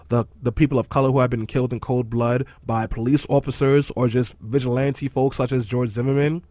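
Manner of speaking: normal talking voice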